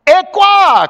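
high, loud French exclamation; sharp rise in pitch and volume; cried out. A narrator's reading. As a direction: cry out from the crowd. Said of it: "cried a listener".

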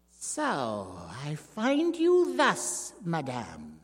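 a lightly voiced cold dwarf, drawn out; menacing dwarf. A narrator's reading. The dwarf finds his wife with her lover, coldly. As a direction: savour the catch, slow and menacing. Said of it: "Monsieur Jacques Courbet said at last".

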